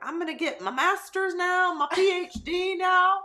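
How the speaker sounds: high, loud mock old-woman voice; old lady voice. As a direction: play a peppy seventy-year-old woman. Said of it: "I don't know why I have this accent when I'm seventy".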